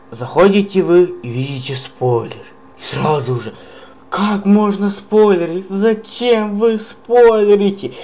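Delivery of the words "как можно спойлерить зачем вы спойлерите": loud, high-pitched outrage; raging gamer. Real, not acted. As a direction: blow up at the spoilers. Outraged at spoilers in comments.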